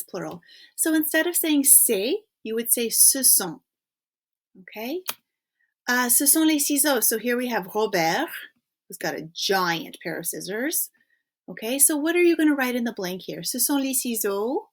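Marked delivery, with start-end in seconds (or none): none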